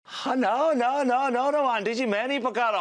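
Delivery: high, hurried string of protests; squirming, high-pitched backpedalling; flustered denial. Acted. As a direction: protest your innocence in a fluster. Hastily denies the accusation.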